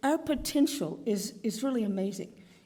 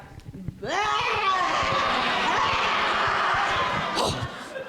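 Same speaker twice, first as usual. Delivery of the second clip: Gray Panther growl; 8 semitones higher than usual. Shown as a demonstration. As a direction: let out a big panther roar, tongue out. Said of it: "you go like this".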